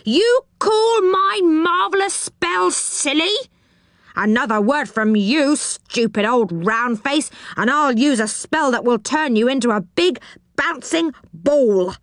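storybook goblin in a temper; enraged goblin. A narrator's reading. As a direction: rage and threaten like an insulted goblin. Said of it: "cried the goblin in a rage".

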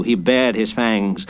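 ordinary delivery, nothing marked

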